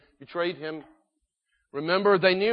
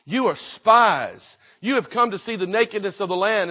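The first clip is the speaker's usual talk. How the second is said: loud, raised accusation; Joseph speaking roughly; 4 semitones higher, 7 dB louder than usual. Acted. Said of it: "He spoke roughly to them".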